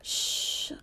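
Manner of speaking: soft, breath-only shushing; very soft, soothing shh; whispered hush. A narrator's reading. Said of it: "I whispered".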